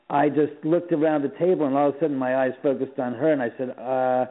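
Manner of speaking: usual speaking voice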